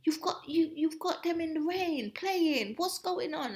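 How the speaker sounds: imitating mum's furious telling off; angry mother. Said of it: "she was really angry".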